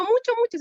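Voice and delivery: "mucho, mucho" leaping high and loud on the first mucho; stressed mucho. Real, not acted. Telling the women how much she doubted, stressing "mucho, mucho".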